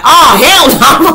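loud, high, drawn-out mock scream; hammed-up outraged yell at the screen. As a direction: holler it slowly in disbelief. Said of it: "screaming at the T V".

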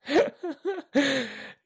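a sudden high burst of laughter; big laugh. Real, not acted. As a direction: laugh out loud in delight. Laughing at the decaf story.

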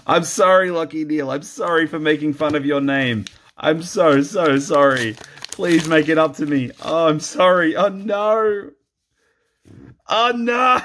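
high mock begging apology; mock pleading. Vlogger begging the footballer for good cards, in a high mock whine. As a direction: whine sorry at the player, half joking.

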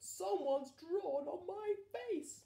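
high, loud, slow outraged complaint; indignant broccoli. Acted. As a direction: wail about the ruined face. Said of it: "cried Broccoli".

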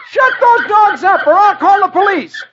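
very high, loud yell out the window. Acted. Dagwood and Blondie yelling from the window at the dogs. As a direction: shout at the howling dogs next door. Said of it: "stick their head out ... and yell".

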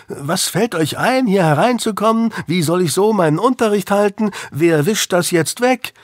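teen mocking the teacher's ranting; imitating a shrieking teacher. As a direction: mimic her shrill scolding lightly. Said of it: "Sie schrie lauter komische Sachen herum".

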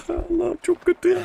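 high laughing whine of despair; laugh-whine like it's hopeless; whiny laughing groan. Real, not acted. Frustrated at the game map.

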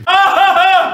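high, loud laughing yelps; laughing yell. Real, not acted. Gamer panicking at the buttons, high laughing yelps. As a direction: yelp with laughter as it all goes wrong.

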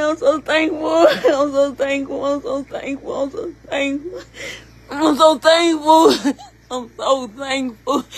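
slow, sobbing repeated thanks; crying thanks. Real, not acted. Woman telling God she is thankful, crying slowly. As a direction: let the tears break through each word.

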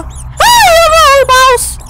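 high, shrill shriek at a mouse; a real yelp of fear; startled scream. Real, not acted. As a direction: scream in sudden fright. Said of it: "Stop screaming!".

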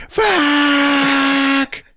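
long, high, loud screamed curse; hammed-up howl of despair; anguished mock scream. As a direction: scream it out, stretched and cracking high. Retold reaction to laudanum being gone.

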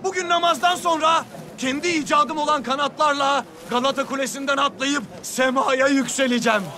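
high proclaiming call; town crier. Acted. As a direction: announce it to the whole town. Proclaiming to the public.

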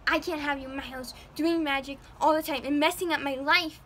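loud, fed-up yelling; angry mom. Retold or imitated. Mother kicking Jack out, loud angry. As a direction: throw him out in a temper. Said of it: "the mother's like".

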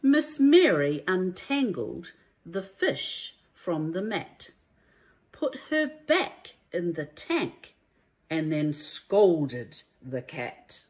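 lively, sing-song rhyming storybook read; reading to children. A narrator's reading. Teacher reading a rhyming picture book to children, lively and sing-song. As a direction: bring each rhyme to life for little listeners.

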